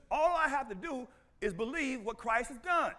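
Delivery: ordinary delivery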